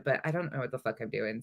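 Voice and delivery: usual speaking voice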